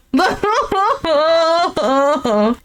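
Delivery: long drawn-out laugh; helpless drawn-out laughter. Real, not acted. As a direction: laugh it out in disbelief. Reacting to the couple's scene.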